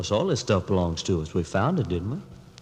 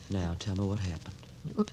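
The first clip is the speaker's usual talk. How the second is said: gentle; 2 semitones lower, 10 dB quieter, slower than usual. Acted. Father soothes shaken daughter.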